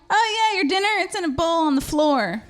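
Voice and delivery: high, sing-song sarcastic tone; mock sweet. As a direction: offer the bowl sweetly. Mocking partner's voice.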